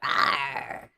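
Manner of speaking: low, breathy dino roar; dinosaur voice. Shown as a demonstration. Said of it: "That's a dino noise".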